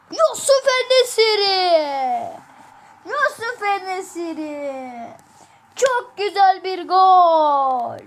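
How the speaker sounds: slow, drawn-out excited goal call; commentator goal call. Acted. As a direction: stretch the name like a commentator.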